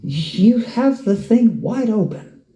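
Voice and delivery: high, pressing disbelief; fired-up counter-argument to scared parents; incredulous retort. Retold or imitated. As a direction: push back hard at the worried parents. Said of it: "I'm like".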